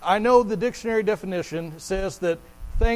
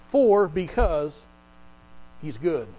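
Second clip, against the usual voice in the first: slow scripture reading; 4 semitones lower, much slower than usual. Shown as a demonstration. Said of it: "Verse one".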